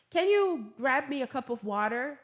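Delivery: plain-spoken, pushy request; blunt request. Shown as a demonstration. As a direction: ask it bluntly, with no softening. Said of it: "ao invés de falar".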